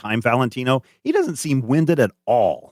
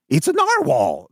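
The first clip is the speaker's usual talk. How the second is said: lightly raised repeat; a quick echo of his line, slightly higher; Doctor West recalled; 6 semitones higher than usual. Acted. GM recapping Doctor West's line, only lightly voiced. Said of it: "Doctor West says".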